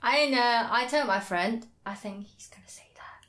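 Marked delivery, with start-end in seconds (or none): 1.8-3.3 hushed, voiceless whisper; whispered aside. Retold or imitated. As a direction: whisper it to the friend beside you. Quoting whisper to friend in audience.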